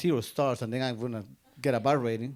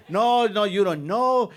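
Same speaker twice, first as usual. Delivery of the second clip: higher, louder mock protest; insistent arguing; 6 semitones higher, 6 dB louder, slower than usual. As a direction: cut in as an argumentative passenger, raised voice. Acted passenger arguing back at driver.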